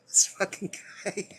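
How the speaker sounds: words squeezed out through laughter; giggling at the book; laughing. Real, not acted. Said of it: "This is fucking hilarious".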